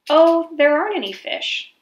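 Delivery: high, loud, smiling taunt; smiling, gloating. A narrator's reading. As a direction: grin while you gloat. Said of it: "said Brer Rabbit with a smile".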